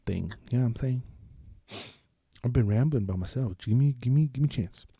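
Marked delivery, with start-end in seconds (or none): none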